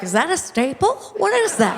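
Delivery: rising, squinting "what is that?"; puzzled up close. Retold or imitated. Inspecting a cheap dress, mildly baffled. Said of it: "you're like".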